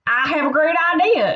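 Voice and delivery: put-on high-pitched exclamation in a country accent; exclaiming. Kansas exclaiming a party idea to Nebraska, excited. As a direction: announce it high and eager. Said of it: "exclaimed Kansas".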